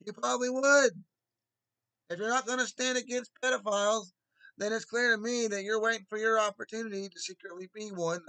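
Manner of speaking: loud angry accusation; heated shouting. Real, not acted. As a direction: hammer the accusation hard. Angry rant continues.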